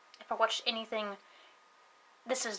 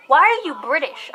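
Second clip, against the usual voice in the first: loud, higher mock accusation; retold blunt challenge; 4 semitones higher, 10 dB louder than usual. Classmate to speaker in lunch line, loud challenge. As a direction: call out the question like a puzzled schoolkid.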